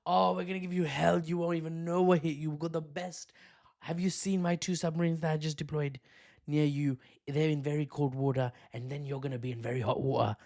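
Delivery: badly faked American president making threats; Trump impression. Trump threatening Putin with submarines, hammed-up impression. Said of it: "I need to work on my Trump accent".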